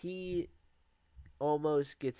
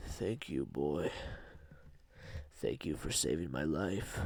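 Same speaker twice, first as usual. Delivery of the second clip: grateful monster; 9 semitones lower, 6 dB quieter than usual. Retold or imitated. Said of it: "And he's like".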